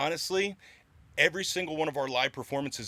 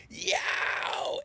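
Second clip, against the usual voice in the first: high breathy scream into the mic; whisper scream; 6 semitones higher than usual. Shown as a demonstration. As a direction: airless fry scream on Yow!. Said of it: "you just bring the mic in close and you go".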